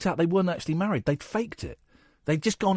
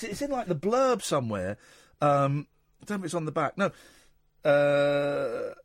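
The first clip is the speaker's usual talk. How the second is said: normal talking voice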